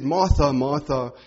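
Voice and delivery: usual speaking voice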